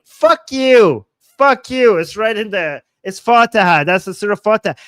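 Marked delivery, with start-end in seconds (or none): 0.0-2.0 loud, high furious outburst; angry retort. Real, not acted. Streamer cursing critics of the recitation, real angry outburst. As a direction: throw it back at them at full volume.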